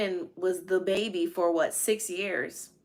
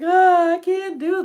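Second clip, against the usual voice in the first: high, much louder despairing cry; overwhelmed cry; 11 semitones higher, 10 dB louder than usual. Retold or imitated. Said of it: "I was just like".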